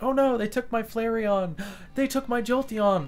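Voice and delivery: high, hammed-up cartoon lament; high-pitched mock despair; mock dismay. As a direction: imitate a distraught character, gasping between losses. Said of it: "where it's just like".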